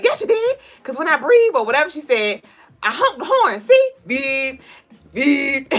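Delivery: high, loud sassy diva voice; Rasputia impression. Streamer quoting Rasputia bragging about the horn. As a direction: boss him and honk the beeps. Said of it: "She's like".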